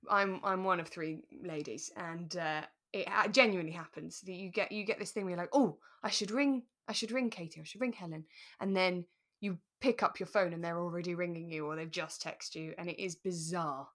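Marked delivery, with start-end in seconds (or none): none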